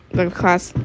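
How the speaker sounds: usual speaking voice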